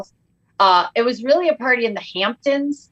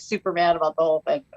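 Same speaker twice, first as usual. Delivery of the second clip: usual speaking voice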